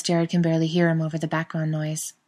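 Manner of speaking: ordinary delivery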